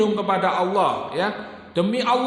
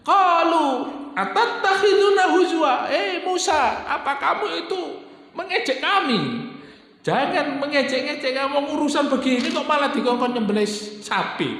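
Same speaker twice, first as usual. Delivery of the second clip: higher pitched indignant complaint, laughing at first; angry retort; 8 semitones higher than usual. Acted. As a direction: throw it back at Musa as an insult. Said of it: "Marah-marah, Pak, itu, Pak".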